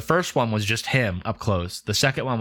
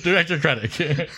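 laughing; 8 semitones higher than usual. Real, not acted.

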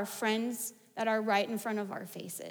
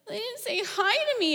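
put-on high, plaintive toddler voice; pouting child; 9 semitones higher than usual. Ignored three-year-old complaining to mom, pouty and hurt. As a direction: complain like a pouty little kid. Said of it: "huge pout on his face".

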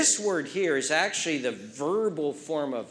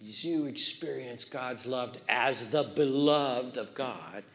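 ordinary talk, nothing marked

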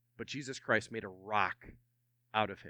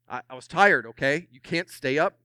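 louder, stung and defensive; defensive excuse; 2 semitones higher, 8 dB louder than usual. Acted. Simon making excuses for sleeping, defensive and louder. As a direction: push back like you were caught napping. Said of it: "There's a Simon moment".

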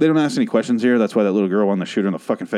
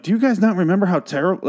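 incredulous mock rant; 9 semitones higher than usual. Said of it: "And I'm like".

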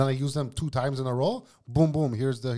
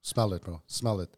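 usual speaking voice

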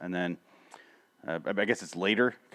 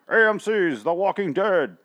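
big, higher, slow trailer-announcer read; 8 semitones higher, 5 dB louder than usual. Shown as a demonstration. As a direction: boom it out like a TV channel voiceover. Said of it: "I'm doing the announcer voice".

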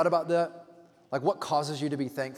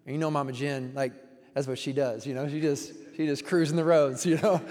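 ordinary talk

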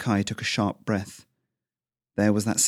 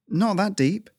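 put-on much higher, small boy answer; boy's voice; 11 semitones higher than usual. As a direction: answer your dad timidly.

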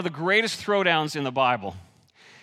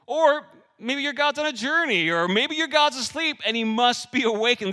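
higher, louder sneering taunt at the false prophets; mocking taunt; 8 semitones higher, 5 dB louder than usual. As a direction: jeer at them, voice pitched up and pushed. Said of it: "Do you hear this mocking?".

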